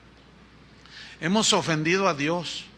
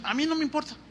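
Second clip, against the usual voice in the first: mimicking worldly fans; 6 semitones higher, 5 dB louder, much faster than usual. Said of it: "dicen los del mundo".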